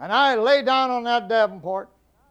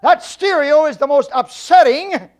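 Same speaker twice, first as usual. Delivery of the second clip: louder, higher, slow furious outburst; angry rant; 6 semitones higher, 8 dB louder, slower than usual. Retold or imitated. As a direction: let the husband blow up at the stereo. Angry husband rants at wife.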